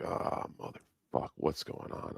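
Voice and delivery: hushed whisper of disbelief; whispered retold reaction; whispered inner thought. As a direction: whisper it like thinking to yourself.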